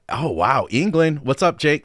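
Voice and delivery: normal talking voice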